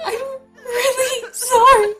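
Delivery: slow, high, loud sobbing apology; weeping. A narrator's reading. Weeping friend apologising at the hospital bed, sobbing. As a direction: drag the words out through tears. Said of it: "Jules wept".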